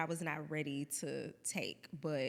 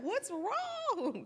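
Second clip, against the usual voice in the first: worried mom; 14 semitones higher, 3 dB louder than usual. Retold or imitated. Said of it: "They're like".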